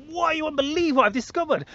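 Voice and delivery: high, eager rush of news; excited. Retold or imitated. Telling friends his discovery, high and excited. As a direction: burst out with the big discovery. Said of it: "I was, like, so excited to tell everyone".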